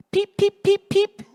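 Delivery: high tiny peeping chick voice; baby chick peeps. Acted. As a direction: squeak it like a newborn chick. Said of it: "it said".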